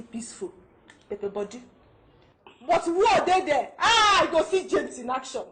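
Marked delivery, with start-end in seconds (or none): 2.6-5.4 loud, high, gleeful exclaiming; animated warning. Acted. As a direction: hype up the trouble to come. Wife warns of husband's temper.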